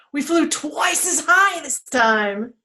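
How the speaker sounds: high, slow, loud reveal; excited storytelling. A narrator's reading. Storyteller revealing to children what they did, excited. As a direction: announce it high and drawn out.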